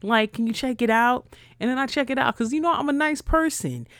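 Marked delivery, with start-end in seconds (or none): 0.0-1.3 higher, sing-song sales pitch; mock MLM seller. Mocking an MLM seller's pitch to viewers, higher and sugary. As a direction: imitate the pushy seller sweetly. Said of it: "how the fuck are you gonna tell me".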